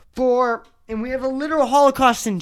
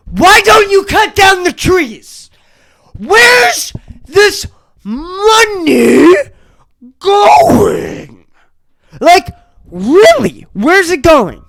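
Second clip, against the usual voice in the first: loud, high, pleading outcry; angry rant; 7 semitones higher, 6 dB louder, slower than usual. Real, not acted. Venting to viewers about Boston prices, crying out loud and high. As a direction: yell it at the city in disbelief.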